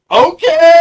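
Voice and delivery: high, loud impressed okay. Retold or imitated. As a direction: react like you just saw magic. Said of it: "I'm like".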